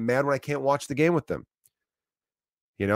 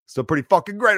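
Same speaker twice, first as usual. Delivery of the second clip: higher and louder on the repeat, pushing the upbeat line; emphatic repeat; 8 semitones higher, 6 dB louder than usual. Retold or imitated. Bartender cheering up a regular, emphatic and louder. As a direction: pump it up, lean hard on great.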